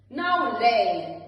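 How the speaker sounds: loud gruff command; ordered. A narrator's reading. Giant commanding his hen to lay, loud order. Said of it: "the giant ordered".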